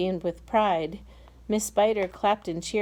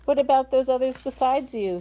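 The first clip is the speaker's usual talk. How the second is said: higher, louder shouted question; shouting; 6 semitones higher, 6 dB louder than usual. Acted. Police chief calling up to James, shouting. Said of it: "shouted the chief of police".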